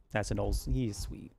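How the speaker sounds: usual speaking voice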